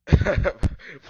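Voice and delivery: sudden loud burst of laughter; laughing. Real, not acted. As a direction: crack up mid-reading. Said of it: "I'm laughing because".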